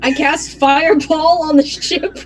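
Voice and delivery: gleeful, barely holding in laughter; giggly excitement. Real, not acted. Player gleefully casting Fireball.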